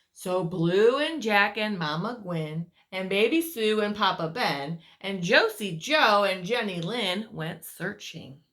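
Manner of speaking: lively read-aloud voice for little ones; storyteller reading to children; story narration. A narrator's reading. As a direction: tell it like a bedtime picture book. Reads picture book aloud.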